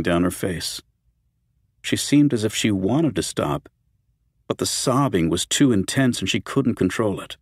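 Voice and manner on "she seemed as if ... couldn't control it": ordinary delivery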